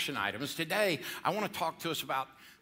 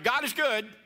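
call to congregation; 5 semitones higher, 4 dB louder than usual. Shown as a demonstration. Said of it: "let's try it again".

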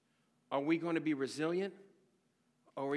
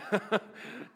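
laugh; 4 semitones higher than usual. Real, not acted. Laughing at pregnancy story.

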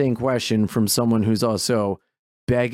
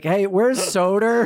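finto richiamo; 7 semitones higher, slower than usual. Pretend. Calls out for Soder, mock searching.

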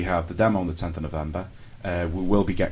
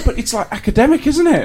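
incredulous; 7 dB louder than usual. Real, not acted. Incredulous comment while laughing.

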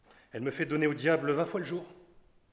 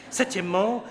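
loud; 5 semitones higher, 6 dB louder than usual. Acted. Doctor repeats his count word.

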